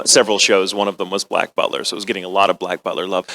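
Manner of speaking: ordinary talk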